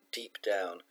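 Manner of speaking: ordinary delivery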